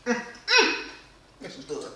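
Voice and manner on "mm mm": high, loud savoring hum; mm of delight. Real, not acted. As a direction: hum with pure food joy. Tasting her macaroni and cheese.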